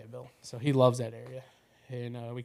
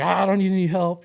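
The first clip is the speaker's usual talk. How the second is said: louder, higher mock protest; indignant protest; 6 semitones higher, 8 dB louder than usual. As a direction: imitate Dad loudly waving off help. Dad protests being helped.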